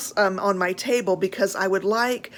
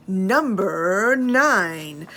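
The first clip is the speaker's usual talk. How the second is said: announcer; 4 dB louder, much slower than usual. Real, not acted. Announcing next bag number.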